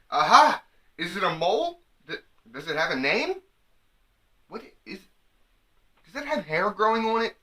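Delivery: high, eager prying questions; nosy excitement. Pretend. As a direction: pounce on it, loud, then probing. Mock quote of nosy woman.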